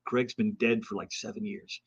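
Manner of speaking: low, quiet deadpan; understated, hushed reveal; retold letdown. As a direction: deliver the punchline softly, voice dropping away. Breaking the news to duped fan.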